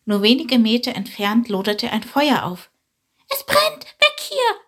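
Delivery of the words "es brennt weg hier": alarmed shout. Acted. Little sparrow Chilp warns his friend of fire, shouting high. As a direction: yell a fire warning, high and urgent. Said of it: "rief Chilp".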